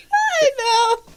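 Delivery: high laughing squeal into laughing talk; laughing hard. Real, not acted. Laughing at the family's fake football draft. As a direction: crack up while trying to say it.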